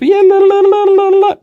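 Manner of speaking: high, loud la-la babble; giddy babbling. Acted. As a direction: babble gleefully, lost in your own world. Johnny runs back and forth obliviously.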